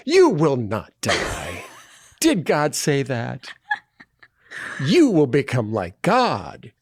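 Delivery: slow, sly tempter voice; a hint of a serpent tempter, drawn out; tempting devilish whisper. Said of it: "We are now tempting the snake".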